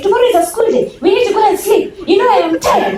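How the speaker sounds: high, loud, laughing mock of mum; mocking tired mum. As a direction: parody the tired mother's excuses. Mimicking mother's bedtime excuse.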